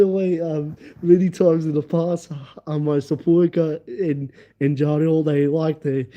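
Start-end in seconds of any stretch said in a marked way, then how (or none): none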